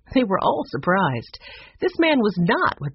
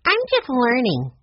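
put-on higher-pitched little girl voice; young girl; 7 semitones higher, 3 dB louder than usual. A narrator's reading. Young Sue Lee answers shyly.

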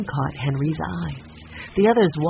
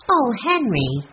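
high, swooping protest; dismayed cry; 7 semitones higher, slower than usual. A narrator's reading. Violet protests her brother suspecting Andy, dismayed cry. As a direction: cry out in mild dismay, pitched up. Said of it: "cried Violet".

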